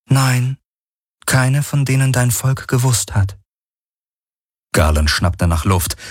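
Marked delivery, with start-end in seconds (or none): 0.1-3.4 Helix voice. Acted. The Helix answers Galen.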